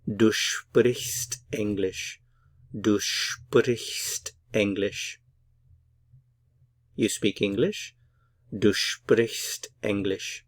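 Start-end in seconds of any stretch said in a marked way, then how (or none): 0.0-5.2 slow, every word of Du sprichst English clear; slow model sentence. Shown as a demonstration. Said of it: "So put it all together". 8.6-10.5 slow, every word of Du sprichst English clear; slow model sentence. Shown as a demonstration. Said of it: "So put it all together".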